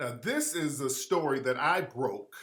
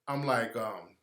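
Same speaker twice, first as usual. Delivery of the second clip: ordinary talk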